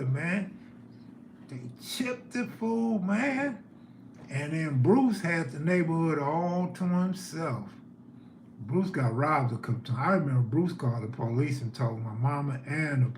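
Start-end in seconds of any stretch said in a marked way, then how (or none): none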